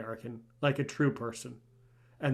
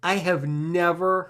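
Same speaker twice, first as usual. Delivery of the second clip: excited; 5 semitones higher, 7 dB louder than usual. Real, not acted. Host reacts excitedly to story.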